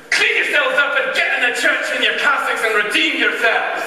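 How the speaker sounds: high, loud stern command; angry order. Retold or imitated. Choirmaster ordering muddy boys into church, angry. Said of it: "he got more angry".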